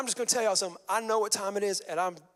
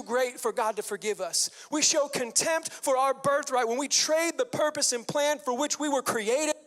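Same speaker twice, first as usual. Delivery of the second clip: higher, louder, building sermon cadence; fervent preaching; 6 semitones higher, 4 dB louder than usual. Acted. Preacher pleading with his congregation, fervent and rising. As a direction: drive each line home to the congregation.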